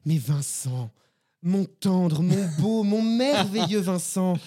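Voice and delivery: slow, drawn-out fawning voice; butter him up, slow and mocking; mock listener voice. Voicing listeners who coo at Vincent, slowly. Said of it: "vous avez envie de me dire".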